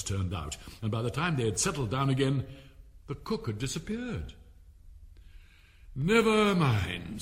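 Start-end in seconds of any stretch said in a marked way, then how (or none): 5.9-7.2 slow, louder relieved sigh; great relief. Acted. King relieved the cook has gone, slow and loud. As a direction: let it out with relief. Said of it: "with an air of great relief".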